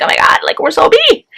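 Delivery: mother mid-labour asking where the doctor is, panicking; panicked disbelief. Retold or imitated. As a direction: whisper then shriek it in alarm. Pushing without the doctor, panicking.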